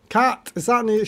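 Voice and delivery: ordinary talk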